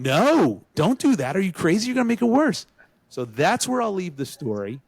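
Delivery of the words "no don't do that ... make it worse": urgent warning. Retold or imitated. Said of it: "And we're going".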